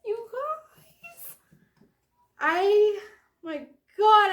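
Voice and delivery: high, choked-up squeak bursting loud; real overwhelmed disbelief. Real, not acted. Telling viewers about her signed favorite book, overwhelmed disbelief. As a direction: start small and high, then burst out.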